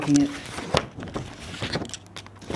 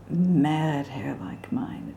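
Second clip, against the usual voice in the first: whispered aside; 3 semitones lower, 8 dB quieter, much slower than usual. Real, not acted. Aside about own hair.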